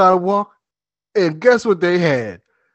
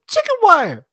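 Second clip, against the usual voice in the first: put-on kid voice. Kids answer.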